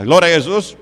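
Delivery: loud ringing exclamation; shouted praise. Real, not acted. Pastor shouting praise to the church, loud and fervent. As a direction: call it out to the congregation.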